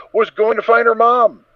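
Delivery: can't believe her luck; excited. A narrator's reading. Filly's glee.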